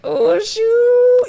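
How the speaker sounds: high, loud drawn-out squeal of being caught; exaggerated uh-oh, voice shooting way up; mock dismay. Woman caught friend-zoning her buddy, mock dismay.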